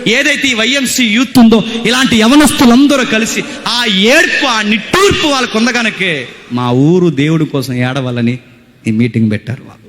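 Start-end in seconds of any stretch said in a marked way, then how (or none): 0.1-8.4 high-pitched, insistent sermon delivery; fervent preaching. Real, not acted. Preacher praising the town's young people, fervent preaching.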